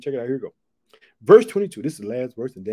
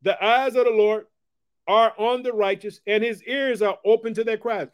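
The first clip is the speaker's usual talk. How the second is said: raised, ringing scripture reading; scripture proclamation; 4 semitones higher, 4 dB louder than usual. Acted. Preacher reading the psalm to his viewers, ringing and raised. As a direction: proclaim each phrase to the congregation.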